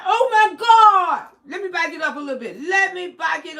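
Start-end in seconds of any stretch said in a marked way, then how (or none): 0.1-1.3 slow, loud and higher mock cry; imitated shocked cry. Retelling what the boy on the call cried, loud and slow. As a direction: replay the boy's stunned cry. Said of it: "he said".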